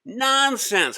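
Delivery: put-on boy defying the teacher, cocky; defiant declaration. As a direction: brush off the warning boldly. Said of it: "Hari declared".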